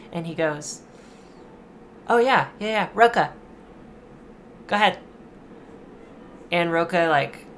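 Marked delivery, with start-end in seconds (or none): 2.1-5.0 put-on loud, high boy calling his friend up; a cocky kid pointing out his friend; eager kid. Remy eagerly volunteers Roka.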